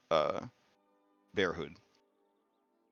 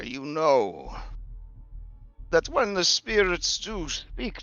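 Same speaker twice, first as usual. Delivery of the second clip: raised, solemn prophetess; seer woman voice; 8 semitones higher than usual. Acted. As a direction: speak prophecy, slow and grave. GM voicing Dula.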